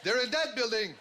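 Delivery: high loud shout; mock shout. Mountie leaps on the gunman with a loud shout. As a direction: yell as you pounce.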